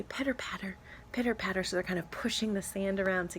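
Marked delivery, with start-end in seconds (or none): 0.0-1.6 soft little pitter patter; hushed pitter patter of baby turtles. Acted. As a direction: patter it out lightly like tiny feet. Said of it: "They say".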